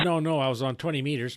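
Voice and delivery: usual speaking voice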